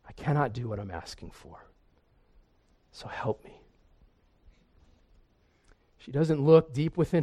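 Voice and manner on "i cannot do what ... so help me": softer and lower, ending in a whisper; whispered plea for help; hushed admission. Acted. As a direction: confess it under the breath. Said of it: "So she admits".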